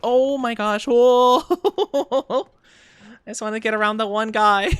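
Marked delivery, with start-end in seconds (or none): 0.0-2.5 loud startled whoa into laughter; laughing surprise. Real, not acted. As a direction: get caught off guard and crack up.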